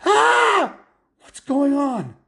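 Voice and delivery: panicked dad screaming into the mirror. Acted. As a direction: scream at his own reflection. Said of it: "he screamed".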